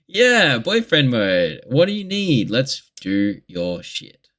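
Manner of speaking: high, put-on eager helper voice; mock-obliging, swooping high then slowing down; boyfriend mode. Boyfriend tending to drunk partner, put-on helpful voice. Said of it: "I enter boyfriend mode again".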